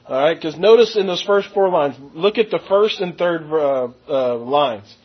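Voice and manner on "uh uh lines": ordinary talk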